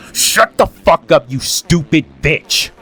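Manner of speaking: slow, loud, furious retold shout; angry snap. Telling Samantha off after she crossed the line, furious shout. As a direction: reenact losing your temper, loud and high, each word drawn out. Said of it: "that's when I snapped and I said".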